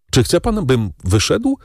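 high, hurt question; pained, pleading exclamation; pained cry. A narrator's reading. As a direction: ask it as if wounded. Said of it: "zawołał boleśnie Morel".

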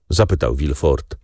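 ordinary delivery

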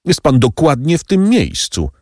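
a touch of panic; crying out. A narrator's reading. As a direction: warn him urgently where he stands. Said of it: "zawołał".

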